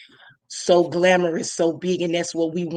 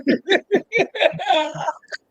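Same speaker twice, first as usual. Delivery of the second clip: a burst of high, emotional laughter; 12 semitones higher than usual. Real, not acted. Co-host laughing, touched by the guest's tears. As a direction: laugh it out, caught off guard.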